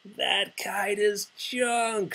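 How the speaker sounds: high, slow, sneering robin voice; mocking robins. Robins mocking Toad's failed kite, high sneer. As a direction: jeer it down from the bush. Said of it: "said the robins".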